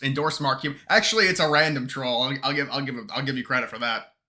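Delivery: ordinary talk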